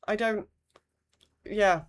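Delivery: ordinary delivery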